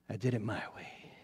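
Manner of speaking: a defeated man murmuring to himself; quiet self-reproach. Retold or imitated. Blind Samson muttering to himself.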